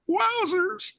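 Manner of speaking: high goofy stoner voice; Shaggy. Retold or imitated. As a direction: squeak it out like a cartoon slacker. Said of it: "didn't Shaggy on Scooby-Doo say that".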